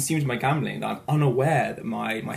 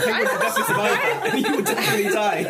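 high, fast, laughing through the words; giggling disbelief at the penguin plan; 10 semitones higher, 4 dB louder, faster than usual. Real, not acted. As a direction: crack up while arguing the point. Laughing at a silly idea.

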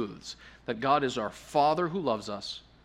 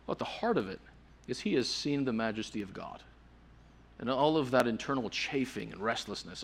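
usual speaking voice

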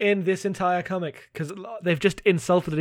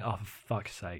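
much softer, lower, fed-up mutter; exasperated sigh; 9 semitones lower, 15 dB quieter than usual. Retold or imitated. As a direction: deflate into a weary groan of disbelief. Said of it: "the next panel is her going".

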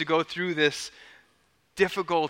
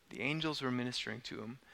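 much softer and quicker, almost to himself; soft repeat; 3 semitones lower, 12 dB quieter, faster than usual. Shown as a demonstration. Pastor echoing the verse to let it land, hushed.

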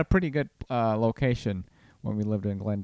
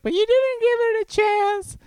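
loud falsetto send-up of a protesting wife; mock wife voice; 5 dB louder than usual. Husband mimicking wife's complaint, mock falsetto protest. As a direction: protest in an exaggerated, very high voice. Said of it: "I am making fun of you".

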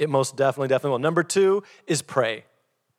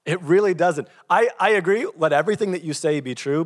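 normal talking voice

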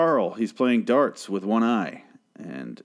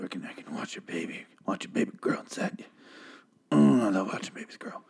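hushed, leering doctor voice; creepy mock whisper; 2 semitones lower, 7 dB quieter than usual. Creepy doctor acted in retelling.

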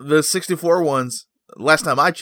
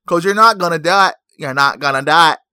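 louder sketch-comedy president catchphrase; SNL president character; 4 semitones higher, 8 dB louder than usual. Pretend. Host scolding Nintendo, mock SNL president impression. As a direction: do a loud caricatured president. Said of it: "That's a S N L character".

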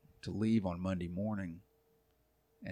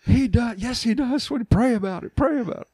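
high, sing-song mock reassurance; sweetly talking her round, pitched up; hammed-up soothing husband; coaxing wife; 8 dB louder than usual. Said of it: "And I said".

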